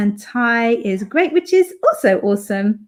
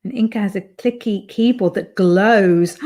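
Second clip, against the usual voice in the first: normal talking voice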